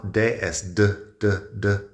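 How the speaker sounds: "D" with the tongue tip behind the teeth ridge, not on the teeth; crisp American "D, D"; teacher showing the American d. Shown as a demonstration. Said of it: "En inglés americano".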